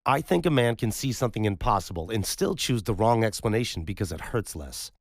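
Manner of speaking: a stern doctor refusing the wolf story; insistent doctor's rebuttal; firm doctor. Acted. Roman confronts Gus firmly.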